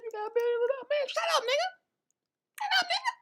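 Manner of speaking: very high, squeaky comic character voice; high squeaky voice arguing with the promoter; squeaky mock voice. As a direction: play a shrill cartoonish sidekick. High-voiced back and forth.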